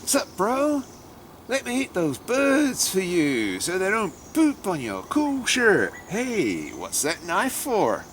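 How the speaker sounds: high, drawn-out fake American accent; hammed-up, badly faked American snake voice. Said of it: "I can't do an American accent".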